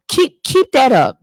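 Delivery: high, loud sarcastic dare; sarcastic warning. Real, not acted. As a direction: throw it back at them sarcastically. Scolding careless churchgoers.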